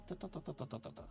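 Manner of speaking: normal talking voice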